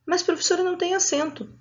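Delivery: small, high voice of a questioning pupil; pupil objecting. Acted. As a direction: raise and soften the voice like a protesting pupil.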